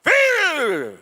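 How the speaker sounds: drawn-out, high parade-ground shout; command shout. Retold or imitated. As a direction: bellow the order across the yard. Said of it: "nos daban la voz de mando". (grito de mando militar)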